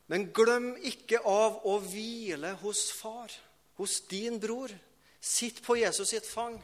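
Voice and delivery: insistent preaching, pitched high; fervent preaching. Real, not acted. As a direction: urge the congregation in a lifted voice.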